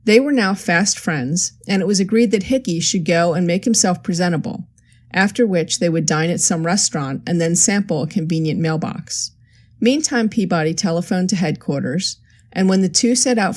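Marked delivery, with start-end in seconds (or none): none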